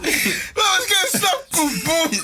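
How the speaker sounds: very high, drawn-out mocking impression; Tezza. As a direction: squeal it out while cracking up. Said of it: "Tezza, Tezza was like".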